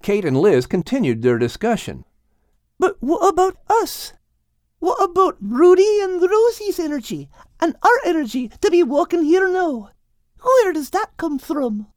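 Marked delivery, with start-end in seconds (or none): 2.8-11.9 put-on young girl voice. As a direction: ask it like a puzzled girl. Kate's lines.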